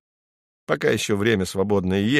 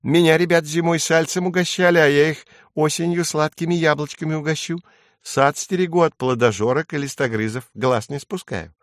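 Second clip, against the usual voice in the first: higher little bird voice; reassuring titmouse; 6 semitones higher than usual. A narrator's reading. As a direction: explain earnestly as a titmouse.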